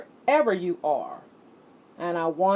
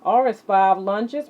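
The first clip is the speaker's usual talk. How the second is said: announcing the letter; 4 semitones higher, 8 dB louder than usual. Acted. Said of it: "announcing next exercise".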